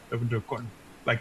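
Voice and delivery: ordinary delivery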